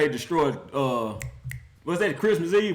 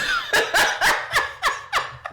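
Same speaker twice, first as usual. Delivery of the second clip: high wheezing laugh. Real, not acted. Laughing at Detroit.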